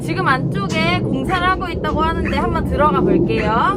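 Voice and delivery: yelling across the room. Real, not acted. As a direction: speak up over the work noise. Talking over construction noise.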